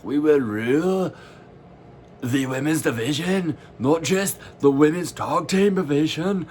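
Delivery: bad Irish accent, high and loud; hammy Irish villain boast. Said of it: "I apologize for this accent".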